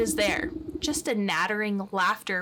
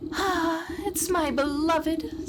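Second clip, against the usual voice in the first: higher, slow lovesick sigh; wistful maiden; 6 semitones higher, slower than usual. Acted. Maiden sighing about her lost love, slow and higher. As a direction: swoon slowly over the beloved.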